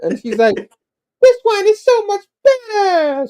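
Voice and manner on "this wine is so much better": very high, drawn-out tipsy squeal; hammed-up drunken lady falsetto; drunk weird lady. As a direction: squeal it slowly like a gushing drunk woman. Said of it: "she's kinda drunk".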